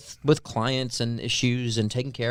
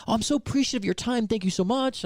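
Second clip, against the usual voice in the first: gushing thanks; 9 semitones higher than usual. Retold or imitated. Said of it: "they're like".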